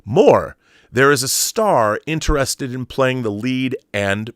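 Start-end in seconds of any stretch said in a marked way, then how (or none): none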